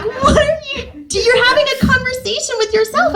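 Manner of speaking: high, squeezed mock-amazed voice; incredulous. Director on the floor at her ad-libs, incredulous.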